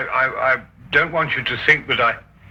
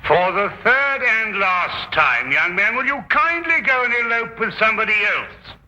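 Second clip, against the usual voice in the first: exasperated; 7 semitones higher than usual. Acted. Cab driver Watson, fed up, turns the young man away sarcastically. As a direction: spell it out with weary sarcasm. Disguised Watson loses patience.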